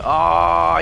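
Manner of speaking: light up at the answer; delighted oh. Real, not acted. Reacting to Yorkshire answer.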